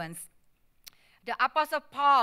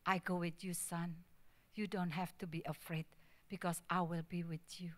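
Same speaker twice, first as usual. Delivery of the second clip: tender reassurance; 4 semitones lower, 9 dB quieter than usual. Retold or imitated. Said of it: "the mom grabbed him and said".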